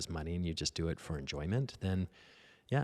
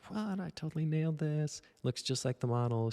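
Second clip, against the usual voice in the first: much higher, thrilled, voice jumping up; excited disbelief; 10 semitones higher than usual. Retold or imitated. Young student boasts to himself after the model's praise, in a much higher thrilled voice. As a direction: crow over the painting like a giddy beginner. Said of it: "I'm like".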